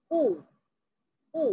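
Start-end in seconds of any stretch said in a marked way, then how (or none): none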